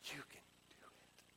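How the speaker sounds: very soft, breathy near-whisper; gentle reassurance. Acted. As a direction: soothe them in a tiny hushed voice. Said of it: "the one that comes along and, and is like".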